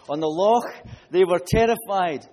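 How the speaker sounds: preacher reading Matthew to the congregation; scripture reading. Acted. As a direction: read the passage out to the church with force. Reads scripture passage aloud.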